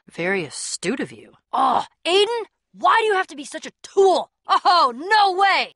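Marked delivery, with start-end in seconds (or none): 4.5-5.7 slow, loud, drawn-out cry of delight; excited disbelief. Acted. Reacting with delight to a surprise. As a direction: stretch it out in loud amazement.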